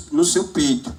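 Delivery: ordinary delivery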